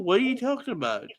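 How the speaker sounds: normal talking voice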